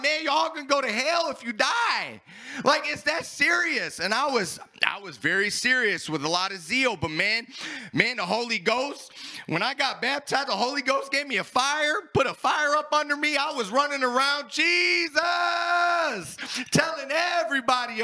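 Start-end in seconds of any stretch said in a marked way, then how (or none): 0.0-2.2 fervent warning. Retold or imitated. 14.5-16.4 high, loud call of the name; shouting Jesus. Retold or imitated. Fired-up convert calling out to the factory floor, shouting.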